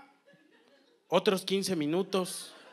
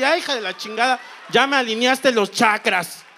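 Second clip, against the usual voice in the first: mock startled shout; 6 semitones higher, 9 dB louder than usual. Girlfriend exclaiming as neck cracks.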